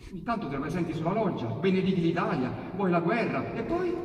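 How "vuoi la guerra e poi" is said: ordinary delivery, nothing marked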